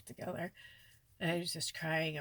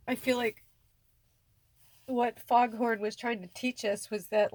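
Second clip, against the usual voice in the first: usual speaking voice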